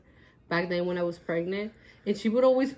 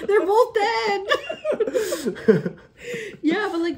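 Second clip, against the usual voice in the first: high, cracking up mid-line; laughing; 12 semitones higher, 6 dB louder, slower than usual. Real, not acted. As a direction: squeak it out through laughter. Dark joke, bursts out laughing.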